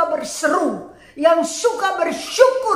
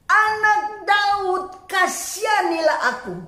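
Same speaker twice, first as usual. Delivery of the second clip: high, long-drawn calling plea; retold beggar calling out, pitch raised; insistent cry; 5 semitones higher than usual. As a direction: cry out for mercy, stretching the words. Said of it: "namun semakin keras ia berseru".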